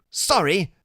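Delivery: put-on high, apologetic kid voice; chattery high. A narrator's reading. Freak declining Iggy's invitation, chattery high. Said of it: "his voice is chattery high".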